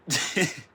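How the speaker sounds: loud high laugh; burst of laughter. Real, not acted. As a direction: crack up out loud. Laughing at own nose.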